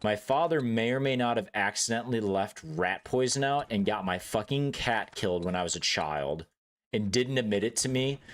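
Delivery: ordinary delivery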